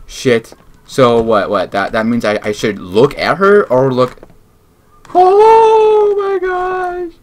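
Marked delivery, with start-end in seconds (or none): 5.1-7.1 high drawn-out shocked "oh my gosh"; startled shriek. Real, not acted. Gamer jolted by a scare, high stretched shriek. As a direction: shriek it slowly in fright. Jump scare in horror game.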